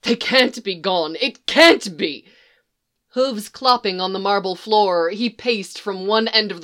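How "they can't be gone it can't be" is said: frantic disbelief. Acted. Tumnus panics at the loss.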